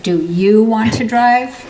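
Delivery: slow, loud, biting do you want to drive; a cutting challenge, slowly; sarcastic drive line. Shown as a demonstration. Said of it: "Yeah, like that".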